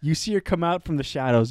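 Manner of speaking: ordinary talk, nothing marked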